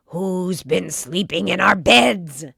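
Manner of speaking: loud bear roar; roaring Father Bear. A narrator's reading. Daddy Bear roars about his bed. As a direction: roar it out at the messed-up beds. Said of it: "he roared".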